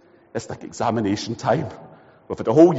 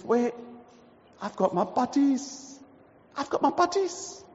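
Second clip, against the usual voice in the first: hopeful Johnny; 10 semitones higher, 6 dB quieter than usual. Acted. Johnny reassures himself.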